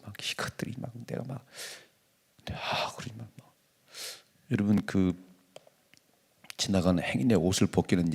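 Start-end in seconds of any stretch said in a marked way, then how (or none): 0.0-3.5 hushed muttering of imagined resentment; whispered grudge. Retold or imitated. (작게 속삭이는 원망)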